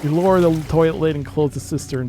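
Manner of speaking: usual speaking voice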